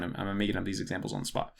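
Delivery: normal talking voice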